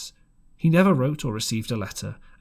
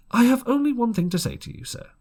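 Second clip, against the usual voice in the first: higher, louder, fast firm retort; doctor's rebuke; 12 semitones higher, 5 dB louder, faster than usual. A narrator's reading. Doctor warns the drunken captain, higher and louder. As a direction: snap back at the ruffian.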